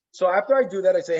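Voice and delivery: ordinary delivery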